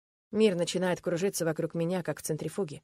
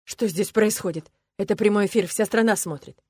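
the coach scolds Marat on live air; angry coach; 4 semitones higher, 7 dB louder than usual. Acted. Loud indignant coach.

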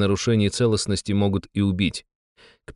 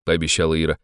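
plain reading voice

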